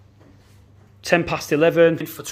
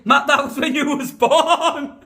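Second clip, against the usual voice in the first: laughing; 11 semitones higher, 3 dB louder, slower than usual. Real, not acted. Laughing tease.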